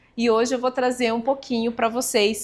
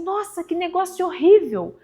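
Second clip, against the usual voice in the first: shocked exclamation; 7 semitones higher than usual. Retold or imitated. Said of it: "ele fala assim".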